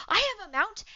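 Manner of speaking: high, loud eager announcement; hyper, bouncy outburst; excited Grace. Acted. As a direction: pipe up, bubbling with excitement. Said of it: "Grace is like".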